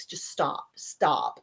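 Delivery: softly whispered daughter, fed up; a hushed plea to just stop; annoyed hush. Retold or imitated. Daughter tired of the song.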